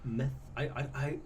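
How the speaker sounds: usual speaking voice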